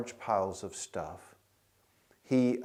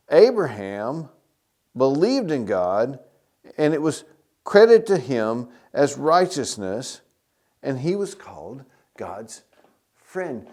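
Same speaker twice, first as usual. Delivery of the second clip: preaching scripture; 2 semitones higher, 8 dB louder than usual. Acted. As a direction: proclaim the verse to the church. Reads scripture with force.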